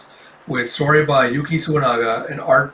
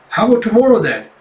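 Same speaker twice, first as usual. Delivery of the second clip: high, louder eager question; acted impatient eagerness, pitched up; eager proposal; 8 semitones higher, 5 dB louder, faster than usual. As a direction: jump in brightly, peaking on tomorrow. Eager pupil proposes tomorrow.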